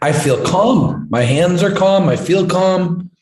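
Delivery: amazed calm. Retold or imitated. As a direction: marvel at the calm, drawn out. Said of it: "He's like".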